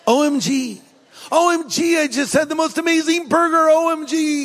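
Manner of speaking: giddy text-speak read aloud; rising excitement. Retold or imitated. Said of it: "Or you text".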